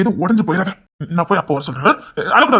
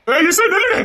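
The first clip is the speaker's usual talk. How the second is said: calling out; 8 semitones higher, slower than usual. Acted. Husband calling after fleeing wife.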